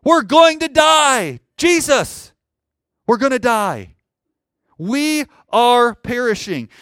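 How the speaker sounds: high, loud, slow wail of doom; panicked disciples' cry. Acted. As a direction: cry out to Jesus in acted panic. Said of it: "They're in danger".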